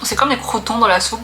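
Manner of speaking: ordinary talk, nothing marked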